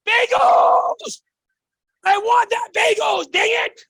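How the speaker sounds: imitating an eager immigrant, shouted high and loud; hammed-up yell as a newcomer craving the bagel; excited yelling. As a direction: yell it like you're grabbing the prize. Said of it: "Now, why am I yelling?".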